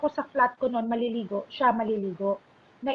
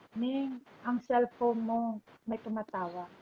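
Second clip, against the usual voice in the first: slow, soft, tender imitation of a father; gentle tender voice; 8 dB quieter than usual. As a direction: imitate a softly spoken father, slowly. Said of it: "Ang lambing daw ng boses".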